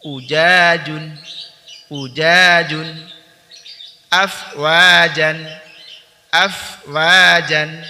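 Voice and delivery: loud, clear model recitation; recitation drill. Shown as a demonstration. Tahsin teacher modelling words for students, loud clear recitation. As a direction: recite each word loudly for students to echo.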